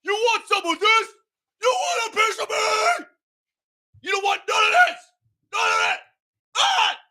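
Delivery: put-on drunken bar-fight shouting, high; belligerent drunk. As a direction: yell like a picking-a-fight drunk. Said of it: "He said as he put on".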